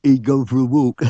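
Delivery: crooked mate laughing at the lad. Acted. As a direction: chuckle through the words. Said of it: "laughed the driver's mate".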